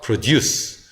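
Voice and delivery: produce as "pruh-DUCE", slowly; weak schwa, then stress the second syllable of produce; end stress. Shown as a demonstration. Teacher modelling the verb form, slow pruh-DUCE for produce. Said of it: "강세가 뒤에 가니까 이거는 슈어로".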